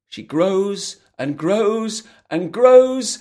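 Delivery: slow growing build. A narrator's reading. Narrator stretches growing.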